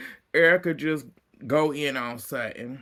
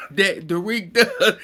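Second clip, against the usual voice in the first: laughing while telling; 4 semitones higher, 7 dB louder than usual. Real, not acted.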